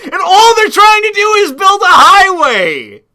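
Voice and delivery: high, loud mock-exasperated cry; loud, high-pitched comic exasperation; exasperated outburst. Exasperated punchline of retold scene.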